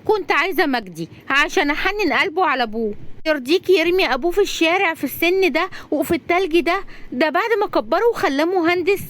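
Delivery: high, pleading female-character voice; high, animated appeal; impassioned young woman. Acted. Razan voiced in story.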